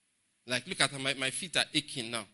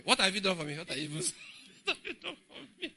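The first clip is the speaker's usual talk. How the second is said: mock grumbling complaint; 5 semitones higher than usual. Preacher mocking a grumbling believer, hammed-up whine.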